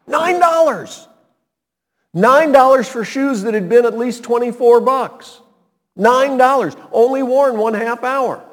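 high, loud exclamation of disbelief; can't believe the bargain, shout it high; rising incredulous cry of the price; excited disbelief. Retold or imitated. Outrage at the low price.

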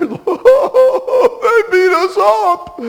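high, slow, hammed-up whine; mock whimpering. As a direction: whimper it like a beaten kid running home. Voicing the army fleeing home.

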